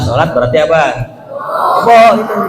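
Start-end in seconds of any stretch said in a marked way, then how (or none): 1.8-2.4 louder, higher punchline; punchline call-out. Acted. Preacher labelling non-praying men, louder and higher. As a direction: hit the joke word.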